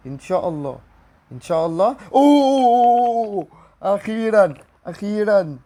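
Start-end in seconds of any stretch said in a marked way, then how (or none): 2.1-5.6 long high drawn-out oh, then loud relief; real relieved excitement; elated shout. Real, not acted. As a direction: cry out, the wait is finally over. Finally gets a special pack.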